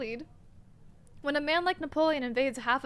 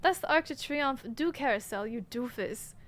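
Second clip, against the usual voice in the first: ordinary talk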